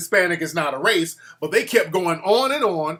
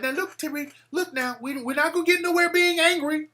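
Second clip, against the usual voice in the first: condescending lady; 10 semitones higher than usual. Retold or imitated. A lady on a TV panel talking down to him, mock condescending. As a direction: talk down to him like a calming lady. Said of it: "She's like".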